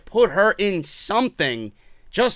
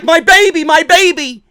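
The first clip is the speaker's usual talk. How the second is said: put-on higher, louder frantic cry of my baby; higher, louder panicked wail; mock panic; 6 semitones higher, 5 dB louder than usual. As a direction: ham up Drake's panic over his baby. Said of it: "And he's like".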